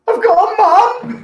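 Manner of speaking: very high, loud mock astonishment; amazed delight. Imitates dental patient told about his mum, squealing amazement. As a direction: squeal it like a thrilled, dazed child. Acting out man after anaesthetic.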